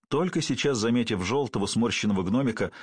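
ordinary delivery

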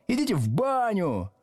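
high-pitched mock yell; rowdy yelling. A narrator's reading. Back desks jeering at the teacher, high yell. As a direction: jeer it from the back row. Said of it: "орали с задних парт".

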